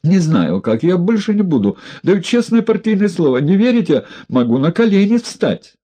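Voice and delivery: high, tearful begging; whiny pleading. A narrator's reading. Одутловатый плаксиво умоляет Егорова о прощении. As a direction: grovel in a whining voice. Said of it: "плаксивым голосом одутловатый".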